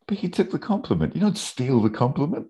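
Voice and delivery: high-pitched, outraged imitation; mock indignation. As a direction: protest the injustice in a squeaky put-on voice. Voices characters' indignant complaint.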